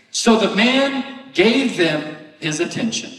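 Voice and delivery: slowed, stressing man; scripture line drawn out; slow emphatic reading. Shown as a demonstration. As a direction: read it out deliberately. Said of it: "important here".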